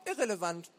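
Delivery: put-on high, curt-worded boy's brush-off; high young know-it-all waving the question away; dismissive boy.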